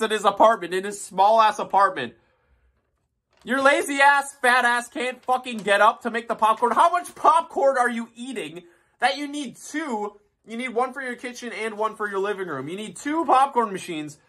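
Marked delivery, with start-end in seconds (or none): 3.4-14.2 mock rant. Mocking rant at Reggie.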